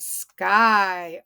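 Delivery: SKY held long on the vowel; drawn out. Shown as a demonstration. Teacher introducing the word to class, SKY drawn out. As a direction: sound out SKY slowly. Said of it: "Yep, it's short".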